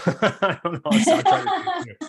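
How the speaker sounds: high squeaky laughing words. Real, not acted. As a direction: laugh through it, embarrassed. Laughing at his own promise.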